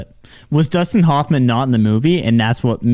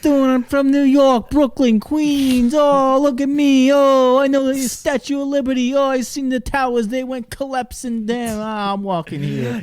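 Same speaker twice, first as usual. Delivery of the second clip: New York cabbie rant; 14 semitones higher than usual. Pretend. Friend imitating a New Yorker, hammed-up, much higher. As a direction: ham up a brash New Yorker. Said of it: "he slaps the cab and he goes".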